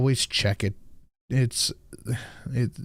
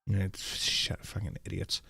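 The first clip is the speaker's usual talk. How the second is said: muttered insult; 4 semitones lower, 11 dB quieter than usual. Real, not acted.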